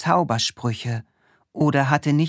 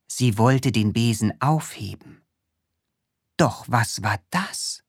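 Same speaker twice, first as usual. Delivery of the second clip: usual speaking voice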